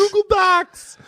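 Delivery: high, slow Walken impression; Christopher Walken. Comic repeating a friend's bit, high drawn-out Walken. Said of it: "do Christopher Walken saying one thing".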